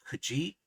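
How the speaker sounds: "keji" with a light first syllable and a rising, accented second; stress on last syllable. Shown as a demonstration. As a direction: lift the final syllable of "keji". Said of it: "the cadence swings faintly".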